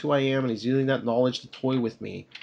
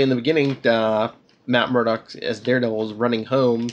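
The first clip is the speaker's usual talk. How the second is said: ordinary talk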